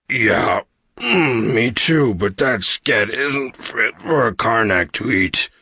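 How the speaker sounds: slow, groaning creature voice; put-on suffering big-creature voice; sick alien hulk. A narrator's reading. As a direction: answer through stomach pain. Sick Karg answering.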